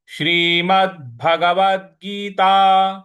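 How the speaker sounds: Shrimad Bhagavad Gita drawn out, final consonants stressed; slow recitation. Shown as a demonstration. Teacher models the title slowly. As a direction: recite slowly with weighted endings. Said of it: "इस प्रकार से बोलेंगे".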